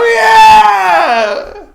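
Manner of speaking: high, loud roaring cheer; crowd roar. Acted. The crowd cheering the gambler, loud roar. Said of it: "And they go".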